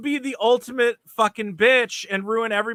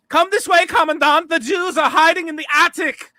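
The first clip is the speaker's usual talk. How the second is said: high, eager tattletale voice; hammed-up snitch, loud and high; mock informant; 6 semitones higher, 5 dB louder than usual. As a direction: gleefully hand them over to the officer. Mocking the women in the ad.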